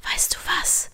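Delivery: soft whisper; tender whispered opener. Acted. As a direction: lean in and whisper. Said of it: "flüsterte sie".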